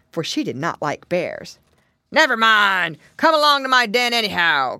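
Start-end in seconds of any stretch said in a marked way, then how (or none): 2.1-4.8 loud, forceful storybook bear; big bullying bear voice; growling bear. A narrator's reading. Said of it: "growled the bear".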